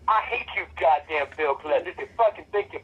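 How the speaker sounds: ordinary delivery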